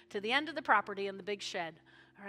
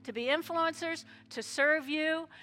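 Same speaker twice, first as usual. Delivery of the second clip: loud, higher fervent closing prayer; preaching prayer; 3 semitones higher, 9 dB louder than usual. Acted. Pastor sending the people out in a loud fervent prayer. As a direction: pray it out with fire.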